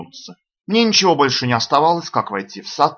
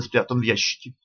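usual speaking voice

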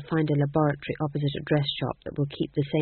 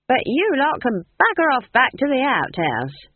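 loud, shooing them off; shouting; 8 semitones higher, 6 dB louder than usual. Acted. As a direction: bark them out the door. Nanny shooing ghosts.

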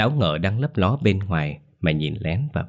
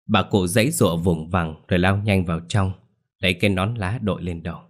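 ordinary delivery

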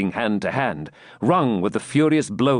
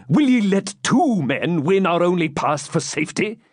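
high, urgent commander's challenge; raised, scolding battle voice; indignant rallying; 8 semitones higher than usual. A narrator's reading. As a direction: shame your men into standing firm. Commander berates his retreating soldiers.

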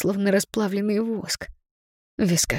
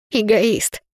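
ordinary delivery

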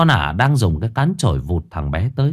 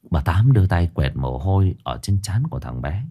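ordinary delivery, nothing marked

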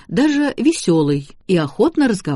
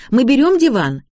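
high, loud calling question; крик; 9 semitones higher, 6 dB louder than usual. A narrator's reading. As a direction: shout the question across the shop. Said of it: "закричала Шарлотта". (громкий вопрос высоким голосом)

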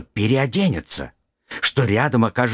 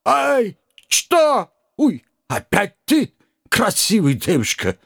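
put-on high, slow old man with Kazakh accent; startled old driver, broken Russian; 11 semitones higher, slower than usual.

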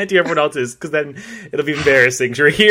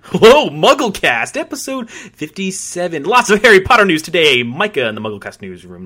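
Andrew Sims impression; 4 semitones higher, 4 dB louder than usual. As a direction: stretch the hello like a podcast host. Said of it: "my Andrew Sims now".

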